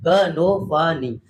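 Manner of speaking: slow finger-counting chant. Shown as a demonstration. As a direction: count each syllable slowly. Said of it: "यहाँ से start करिए".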